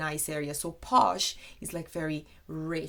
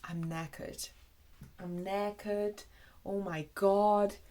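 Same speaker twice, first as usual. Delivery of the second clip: mock exhaustion. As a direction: sound utterly worn out, dragging the words. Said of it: "So you can say".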